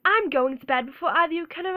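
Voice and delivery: crisp British film-line delivery; British accent. Shown as a demonstration. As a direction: attempt an indignant British schoolgirl. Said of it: "show you British accent".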